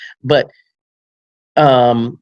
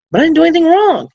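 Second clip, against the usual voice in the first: client pleading innocence, pitched up high; whiny protest, high and loud; 5 dB louder than usual. Retold or imitated. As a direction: protest like a wronged man, higher and louder. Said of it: "he's like".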